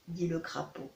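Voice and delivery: ordinary delivery, nothing marked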